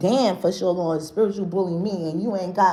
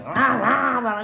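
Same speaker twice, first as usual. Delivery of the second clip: demon growl; 2 semitones higher, slower than usual. Retold or imitated. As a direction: bark out rah like a demon. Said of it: "how somebody demon sound like".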